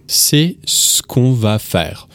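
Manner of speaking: "qu'on" contracted, said very slowly; drawn-out, careful demo of "qu'on"; slow casual model. Shown as a demonstration. Teacher to learners, slow careful casual French form. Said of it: "when we speak casually in French".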